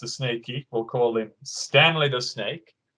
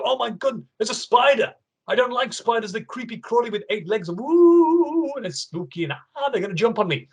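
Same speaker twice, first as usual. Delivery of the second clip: high, squeaky mock panic at a spider; mock fright; 8 semitones higher than usual. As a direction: pretend to be scared of a spider, voice up high. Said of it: "you see a spider and you go".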